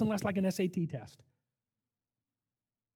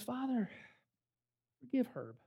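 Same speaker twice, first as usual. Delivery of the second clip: much softer, hushed prayer; soft plea; 5 semitones higher, 9 dB quieter than usual. Retold or imitated. Jesus asking the Father to forgive Herb, softly. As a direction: let it fall quiet and humble.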